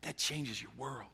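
soft, reverent reflection with long pauses; hushed preaching. Real, not acted. Preacher dwelling on Jesus washing feet, hushed. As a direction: let each phrase land quietly, pausing between them.

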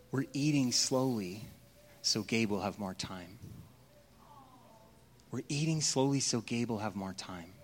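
put-on soft, low, gentle child's reply; hushed sibling explaining gently; quiet child's voice. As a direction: say it softly like a kind child.

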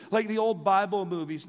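normal talking voice

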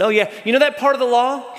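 breezy, high and quick dismissal; mock casual shrug of a voice; dismissive. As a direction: toss the law aside lightly.

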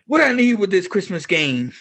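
street punk impression. Punk Breeze brushing off Sam in a mock street punk voice. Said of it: "he says".

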